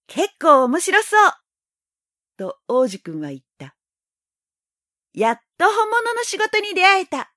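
put-on high, delighted little boy; excited boy. A narrator's reading. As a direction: brighten up like a thrilled child.

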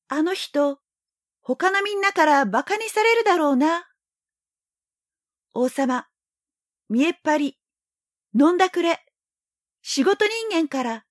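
high, earnest little prince; young boy voice. A narrator's reading. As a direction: speak up bright like a boy. Prince speaks his thoughts.

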